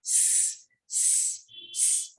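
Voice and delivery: a quiet unvoiced "s", held like a snake; soft hissed s. Shown as a demonstration. Teacher leading the class in the snake sound s. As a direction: breathe out a soft hissing "s". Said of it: "this is s".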